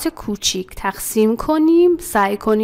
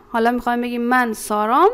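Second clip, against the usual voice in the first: normal talking voice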